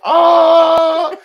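a high, loud burst of "Oh!"; sudden realization. Retold or imitated. Suddenly realizing the answer on her walk home, high loud exclamation. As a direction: shout it as the penny drops. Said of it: "I literally was like".

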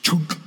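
imitated blade thunk into wood; splintered wood sound. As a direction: punch out a sudden "chunk" sound effect. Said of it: "Makes that nice splintered wood sound".

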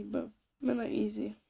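usual speaking voice

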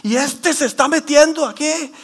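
hammed-up outraged protest; imitated angry yelling. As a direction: mimic an outraged complainer yelling at a guard. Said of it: "le empiezan a gritar al".

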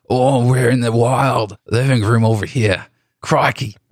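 breezy Australian Crocodile Hunter impression; playful hint of an Australian accent; Australian Steve Irwin accent. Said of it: "That's my Australian Steve Irwin accent".

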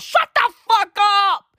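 furious high shout; angry shout. Real, not acted. Ranting at India's leaders, a furious high shout. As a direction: yell it at the politicians.

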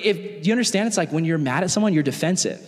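ordinary talk, nothing marked

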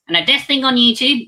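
high puppet voice cutting in, loud; squeaky teddy butting in. Acted. Puppet voice takes over.

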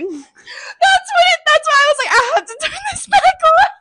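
laughing. Real, not acted. Laughing about the story.

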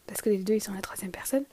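whispering. Real, not acted. Aside under breath.

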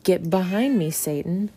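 loud, mildly cross command; mock a stern rebuke, hard on the first words; upset rebuke. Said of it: "sounds like he gets a little upset".

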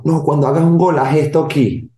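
lightly voiced eager boy; eager kid. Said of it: "Un niño me vino".

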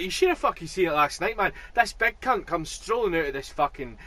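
fast, rough Glaswegian bloke telling a fight story; Glaswegian accent. Retold or imitated. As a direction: channel a wound-up Glasgow local, quick and sweary. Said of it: "so Glaswegian".

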